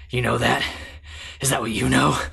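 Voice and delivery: cheesy, overdramatic questioning; mock a hammy movie delivery; cheesy Hawkeye. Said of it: "delivers it in such a cheesy way".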